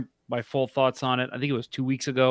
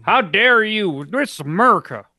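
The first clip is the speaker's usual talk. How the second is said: mock outraged American; 7 semitones higher, 6 dB louder than usual. Mocking a hate commenter, a hammed-up outraged American. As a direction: play an offended flag-waver.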